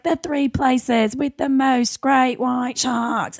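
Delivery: slow, high Aussie narrator drawl; mock Australian TV voice-over; Australian announcer. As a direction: stretch every word like an Australian announcer. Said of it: "it was this Australian announcer".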